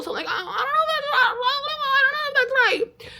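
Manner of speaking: extremely high, put-on squeal; mock-flustered falsetto babble; squeaky hedging. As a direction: protest in a comically tiny squeak. Squeaky hedging against leader's Bible claim.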